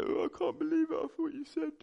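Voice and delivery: high, strained mock sob; imitating own crying. Man weeping at a lamppost, high mock sob. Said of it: "I was crying".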